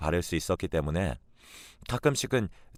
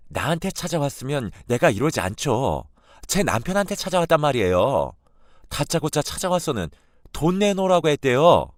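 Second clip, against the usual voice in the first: higher and louder, pressing hard; accusing granddaughter; 6 semitones higher, 5 dB louder than usual. Retold or imitated. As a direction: corner her with the accusation. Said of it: "크게 화를 내고". (할머니를 다그치는 손녀의 성난 목소리)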